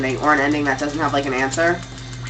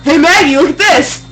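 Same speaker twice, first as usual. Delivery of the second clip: higher, louder imitated shout; mock excited call; 9 semitones higher, 7 dB louder than usual. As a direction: call out like showing off a find.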